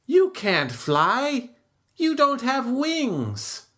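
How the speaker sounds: a light, matter-of-fact bird voice; lightly voiced birds; birds replying. A narrator's reading. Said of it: "The birds replied".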